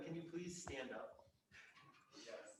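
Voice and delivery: normal talking voice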